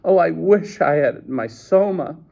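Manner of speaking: high, plaintive wail; distressed wishing. A narrator's reading. Woman sobbing without her Soma, high lament.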